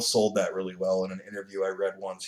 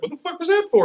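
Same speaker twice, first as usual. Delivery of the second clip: much higher, outraged referee squawk; angry referee; 5 dB louder than usual. Retold or imitated. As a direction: play the baffled ref. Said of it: "The referee's like".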